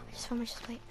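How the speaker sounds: hushed discovery; whisper. Real, not acted. To the viewers, a hushed whisper. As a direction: whisper it like a secret.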